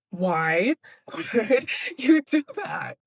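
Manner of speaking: drawn-out, laughing lament; mock dismay. Reader reacting to a book as her heart sinks, mock dismay. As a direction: stretch it slowly in exaggerated despair, half laughing. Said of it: "my heart sunk".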